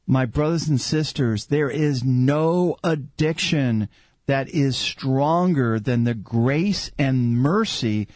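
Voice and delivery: impassioned prayer rising high, then easing and surging back; fervent preaching. Real, not acted. Preaches and prays aloud.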